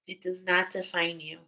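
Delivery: ordinary talk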